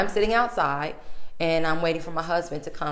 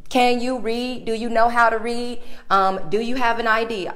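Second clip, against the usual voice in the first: mock rude clerk; 6 semitones higher, 4 dB louder than usual. Rude clerk shoving paper in face.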